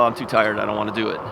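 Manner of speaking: ordinary talk, nothing marked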